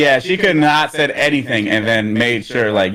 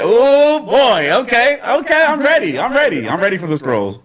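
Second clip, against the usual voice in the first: excited; 7 semitones higher than usual. Retold or imitated. Excited reaction to the scene.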